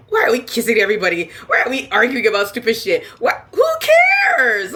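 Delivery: rising to a shrill who cares; exasperated rant. Retold or imitated. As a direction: rattle off the complaints. Quoting her reaction while watching.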